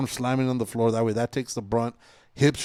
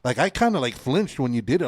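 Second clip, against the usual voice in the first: normal talking voice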